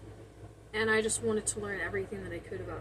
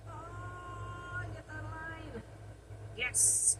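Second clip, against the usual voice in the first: soft focused murmur; leaning in to whisper; 8 semitones higher, 6 dB quieter, slower than usual. Real, not acted. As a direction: quietly talk while working. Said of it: "Sorry, I'm whispering".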